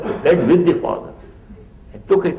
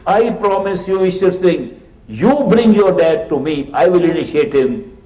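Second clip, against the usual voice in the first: emphatic promise; 6 semitones higher, 7 dB louder than usual. Retold or imitated. Master's firm promise to Ishwar Singh.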